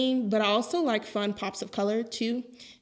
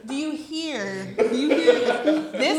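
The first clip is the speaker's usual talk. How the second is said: laughing; 5 semitones higher, 10 dB louder, much slower than usual. Real, not acted. Slow laughing teasing call.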